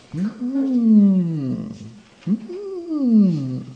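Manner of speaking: high, mock musing hums; mock pondering theologians. Mocking clever theologians pondering the question. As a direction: hum like a wise old scholar. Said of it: "alle de kloge teologer".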